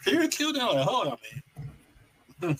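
high-pitched mock shock; incredulous, high retold outburst; acted disbelief. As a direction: replay being stunned, voice shooting up. Repeating his shocked reaction.

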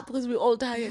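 normal talking voice

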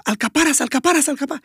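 mock chef yell. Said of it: "הוא צורח".

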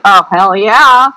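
imitating a keen hell yes; eager yes. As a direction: relish a gladly given yes. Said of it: "she's like".

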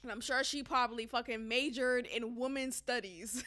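ordinary talk

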